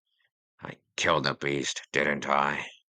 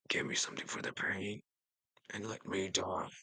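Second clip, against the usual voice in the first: weak whisper; 6 semitones higher, 7 dB quieter, faster than usual. Acted. Dying king begs for relief.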